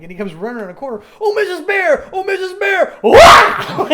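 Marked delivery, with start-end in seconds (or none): 1.2-3.0 high loud puppet call, much higher and louder. Retold or imitated. Rolf runs round the corner calling Missus Bear, loud high call. 3.0-3.9 very high loud puppet yelp; slipping yelp. Retold or imitated. Rolf slips on the icy step, loud high yelp.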